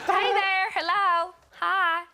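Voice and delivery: put-on American accent, perky and upbeat. Call centre worker greets a caller, American accent. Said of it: "I wasn't expecting to speak to an American".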